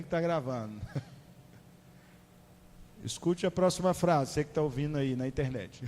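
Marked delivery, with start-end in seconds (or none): none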